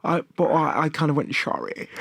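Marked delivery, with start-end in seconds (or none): 1.4-1.9 put-on Sean Connery German accent. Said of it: "strange sort of German Sean Connery impression".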